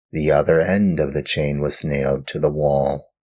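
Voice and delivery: usual speaking voice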